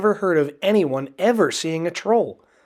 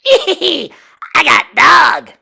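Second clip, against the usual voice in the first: high, loud, slow laughing troll shout; boasting troll laugh; 13 semitones higher, 12 dB louder, much slower than usual. A narrator's reading. Second troll boasting with Toofy in his arms, laughing shout. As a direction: gloat over the prize with a laugh. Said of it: "he boasted".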